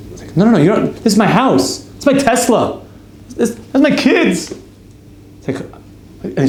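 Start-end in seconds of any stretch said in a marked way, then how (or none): 0.4-4.5 high, louder, rising indignation; indignant protest. Retold or imitated. Stranger insists the house and kids are his, high indignant. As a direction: insist it's yours, climbing higher.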